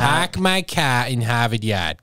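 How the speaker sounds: Boston accent. Shown as a demonstration. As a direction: drawl the vowels, no r sounds. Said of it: "Go for it".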